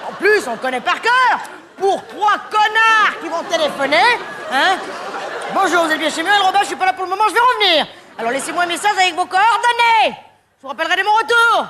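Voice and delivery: high, exasperated, slowed rant; annoyed greeting. Acted. Fed-up comic telling callers off on her answering machine, exasperated. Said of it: "je les engueule un petit peu".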